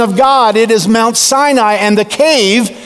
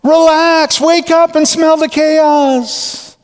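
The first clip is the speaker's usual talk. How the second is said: higher, slow, soothing call; calm him down gently; gentle divine reassurance; reassuring God; 6 semitones higher, slower than usual. Acted. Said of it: "God responds".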